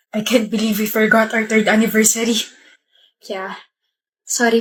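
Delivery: ordinary talk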